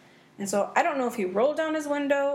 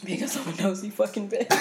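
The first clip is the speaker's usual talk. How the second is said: laughing confession. Real, not acted. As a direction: say it cracking up. Laughing self-label.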